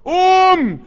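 high, loud قوم; rally the crowd to rise; crowd-rousing cry; shouted refrain. Acted.

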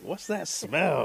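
slow, drawn-out disgusted question; disgusted villager. Acted. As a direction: recoil at the smell, slowly. Villagers smelling the stink.

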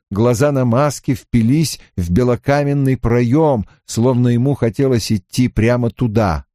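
ordinary delivery, nothing marked

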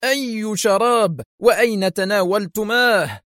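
high, drawn-out angry questions; angry interrogation. A narrator's reading. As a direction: grill him, pitch well up. Boss angrily interrogating his subordinate.

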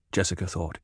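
ordinary delivery

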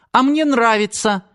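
high-pitched, cheeky challenge; defiant. A narrator's reading. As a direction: throw it back at grandpa with defiance. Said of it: "Лера с вызовом вскинула подбородок". (с вызовом, высоким голосом)

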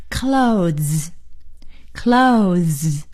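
"clothes" very slowly, ending in a long z; slow pronunciation model. Shown as a demonstration. Teacher modelling twice for students, "clothes" stretched with buzzed ending. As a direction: draw out "clothes", tongue pulling back into a buzz. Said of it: "也就是舌尖呢".

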